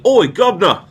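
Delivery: hammed-up British cockney "Oi, Gubna!"; mock British shout. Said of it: "things British people say".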